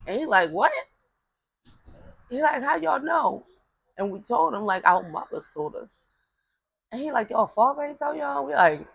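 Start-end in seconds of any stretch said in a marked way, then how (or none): 0.5-3.5 granddad's pitched-up surprised question; mock amazement, higher pitch. Granddad asking how the kids already knew, surprised and higher. 7.3-8.5 higher, disbelieving question; incredulous granddad. Retold or imitated. Granddad asking whether their father told them, incredulous.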